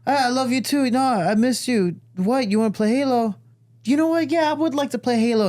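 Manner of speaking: high-pitched mock-gushing dad; over-exaggerated affection. Dad humouring his son's act, over-exaggerated fondness. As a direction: play along in a hammed-up high voice.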